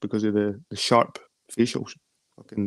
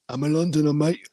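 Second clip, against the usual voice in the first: higher, hammed-up Cockney accent; 5 semitones higher than usual. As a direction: put on a Cockney accent, a touch overdone. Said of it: "I used to have a Cockney accent".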